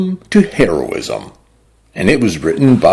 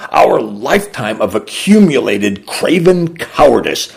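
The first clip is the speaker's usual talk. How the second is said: ordinary delivery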